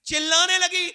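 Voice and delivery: sudden high shout on the word; screaming out. Acted. Preacher shouting as the mother screams. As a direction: cry it out as if you hear her scream.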